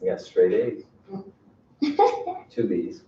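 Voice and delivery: confession that trails off, then a slow, high sing-song on the last grades; a kid hoping the bad grades slip past, drawn out high; sheepish kid confession. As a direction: son owning up to his dad about his grades, drawn out and high. Son confessing grades to dad.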